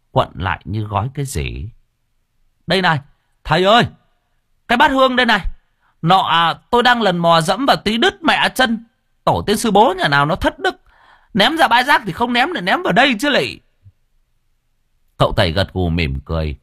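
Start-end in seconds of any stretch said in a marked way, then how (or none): 2.7-13.6 high, loud, griping call from the water; excited diver calling out. Acted. Diver calling to the master from the water, high and loud. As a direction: shout up to the master, grumbling about the cut foot.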